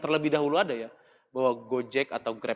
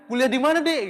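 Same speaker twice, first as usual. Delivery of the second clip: shouted question; 10 semitones higher, 5 dB louder than usual. Retold or imitated. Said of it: "Padahal pertanyaannya".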